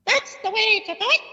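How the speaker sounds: squeaky high Punch voice. Shown as a demonstration. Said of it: "Do it one more time".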